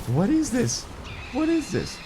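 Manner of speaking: high-pitched baffled "what is this"; squeaky confused double-take; puzzled squeal at the screen. Retold or imitated. Confused gaming recap quote.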